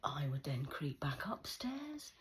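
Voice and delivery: slow hushed whisper creeping upstairs; sneaky whisper. Retold or imitated. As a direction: whisper it like sneaking past parents.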